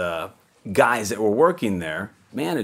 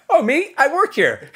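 very high, louder mock-innocent; innocent kid voice; 8 dB louder than usual. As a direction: act all innocent and chirpy. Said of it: "And I go".

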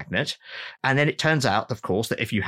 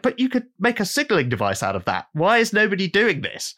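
excited insistence; 8 semitones higher than usual. Retold or imitated. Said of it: "he's like".

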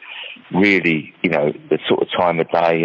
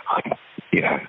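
ordinary talk

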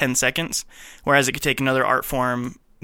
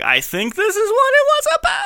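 much higher, climbing into a loud squeak; acted baffled guess; 4 dB louder than usual. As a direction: voice shooting up very high. Acts out baffled viewer guessing.

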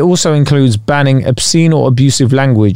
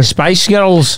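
Scottish accent; 5 semitones higher than usual. Shown as a demonstration. Co-host getting the accent trick, Scottish accent. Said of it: "Spice Girls in a Scottish accent".

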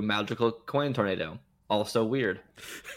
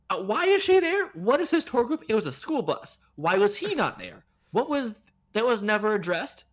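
high-pitched incredulous questioning; baffled plot-hole rant, pitched way up; exasperated disbelief; 9 semitones higher than usual. Real, not acted. Podcaster picks at the film's plot holes, exasperated.

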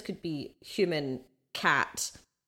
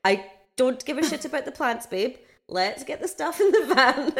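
exasperated; 6 semitones higher, 4 dB louder than usual. Retold or imitated. Partner's retort retold.

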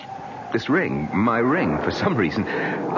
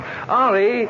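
calling out; 12 semitones higher, 3 dB louder than usual. Acted. Calling to staring Henri.

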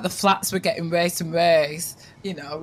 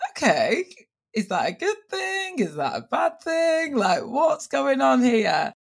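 high-pitched, bewildered run of questions; puzzled questioning; 7 semitones higher than usual. Acted. Wondering to herself what's going on, puzzled. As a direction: voice your baffled inner thoughts, pitched up high. Acted thoughts, puzzled at news.

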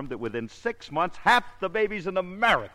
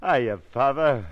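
slow, louder playful papa greeting; pretend dad; 4 dB louder, slower than usual. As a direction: play proud new father to the mirror. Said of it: "look at me in that mirror".

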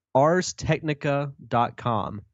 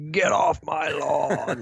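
slow, put-on grumpy geezer; old man; 3 semitones higher, slower than usual. Co-host joining the old-timer joke, mock grumpy old man. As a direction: drag it out like a mock old crank. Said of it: "I sound like an old man now".

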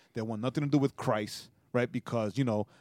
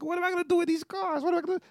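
very high, fast, put-on voice; hammed-up high voice of the rich friend; mock bewildered friend; much faster than usual. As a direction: squeak it out like he's helpless with riches. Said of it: "And he's like".

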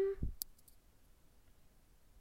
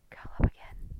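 whispering. Real, not acted. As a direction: whisper to a sleepy pet. Whispering to the dog.

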